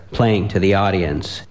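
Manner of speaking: plain reading voice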